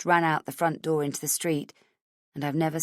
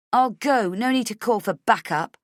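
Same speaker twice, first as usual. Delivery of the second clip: raised snarl at the guard; 5 semitones higher, 4 dB louder than usual. Acted. Heroine snarling at the guard, raised and hard. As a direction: bite the words out. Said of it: "I say, snarling".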